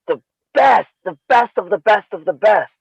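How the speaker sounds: hyped-up praise. Retold or imitated. Storyteller hyping revered master.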